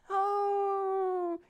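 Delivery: high, strained mom voice; a high, pained mom caught in the middle; mock mom. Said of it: "she has to be like".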